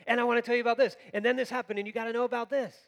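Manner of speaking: high, loud, urgent gushing; excited fast talker. Acted. Mark as an eager storyteller grabbing the listener, excited. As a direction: rush it out like you must tell everyone. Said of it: "he's like a fast talker".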